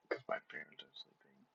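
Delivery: whispering so parents sleep; hushed whisper. Real, not acted. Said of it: "quietly right now".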